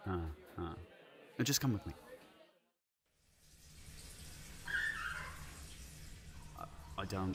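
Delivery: low, quiet, nervous customer; shaken customer. Acted. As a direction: report it nervously. Shaken customer reports eye.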